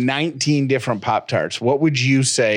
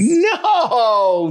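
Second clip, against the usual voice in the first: high shocked "No! Seriously?"; mock outrage; 4 dB louder, much slower than usual. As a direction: play it up as betrayed disbelief. Mock outrage over snack choice.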